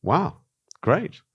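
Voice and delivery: retold high-pitched wow, great; mock delight. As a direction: play it up like thrilled surprise. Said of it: "And I'm going".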